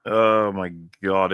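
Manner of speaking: ordinary talk, nothing marked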